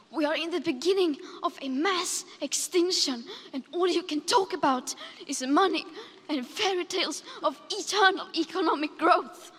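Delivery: impassioned accusation building in force; real outrage, rising to an indignant rebuke; angry and upset. Real, not acted. Young activist accusing leaders of talking only about money, angry. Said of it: "how sad and angry I am".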